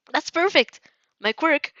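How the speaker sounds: high, loud burst of delight; light up with joy; excited. A narrator's reading. Narrator's character thrilled to meet Kuroiro, high and loud. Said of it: "Your eyes twinkle like stars".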